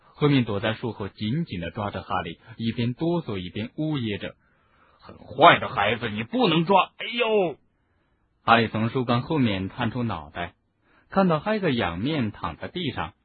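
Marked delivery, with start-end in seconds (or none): 5.0-7.6 loud scolding ending in yelp; scold, then cry out in pain; shouting. A narrator's reading. Hagrid scolding, then hit.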